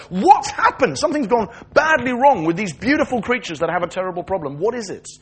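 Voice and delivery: high, impassioned questioning; indignant protest. Acted. People walking round a broken world protesting suffering, indignant.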